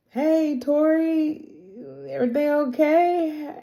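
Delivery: high, drawn-out, careful hello; mock-awkward dad checking in; tentative greeting. Acted tentative greeting to daughter.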